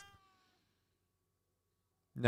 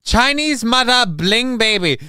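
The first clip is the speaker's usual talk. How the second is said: higher mock echo of Chinese mother bling baby; mocking the bad accent; 9 semitones higher than usual. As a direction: parrot the clip's bad accent, pitched up. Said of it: "the worst English accent ever".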